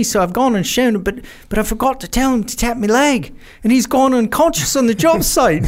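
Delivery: put-on high Australian accent, worried and apologetic; Aussie mate voice. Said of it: "he's really looks scared".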